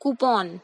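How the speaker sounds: coupon said again as "क्यूपॉन"; correct pronunciation repeated. Shown as a demonstration. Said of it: "I repeat". (दोबारा सही उच्चारण: coupon)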